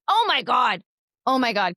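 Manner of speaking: real sudden realization. Real, not acted. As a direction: blurt it out as you realize a slip. Realizes they skipped a part.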